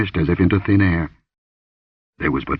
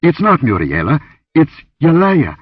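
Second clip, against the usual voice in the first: dawning horror; 8 semitones higher, 5 dB louder than usual. Acted. Realising the dead goddess.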